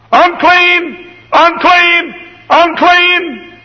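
very high, loud, drawn-out wail; leper's warning cry. Acted. Leper Gehazi warns passers-by with a high loud cry. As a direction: cry it out at full pitch, three times alike.

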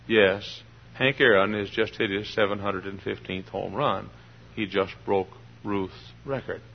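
low, soft, deliberately lifeless play-by-play; unexcited baseball announcer. Acted. Announcer calling Hank Aaron's record homer, dull and unexcited. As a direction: call a historic home run with no excitement. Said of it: "announcer say".

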